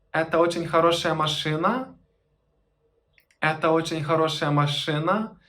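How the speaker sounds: «Это очень хорошая машина» ending on a rise on «машина»; rising question tune, same words; question intonation. Shown as a demonstration. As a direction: turn the statement into a question with pitch alone. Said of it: "just to change the intonation".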